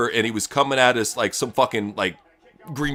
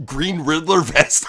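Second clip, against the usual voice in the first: normal talking voice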